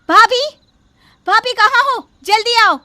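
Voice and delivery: loud, high call across the house; urgent calling out. Acted. Daughter calling her sister-in-law in alarm, loud. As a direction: shout for her to come now.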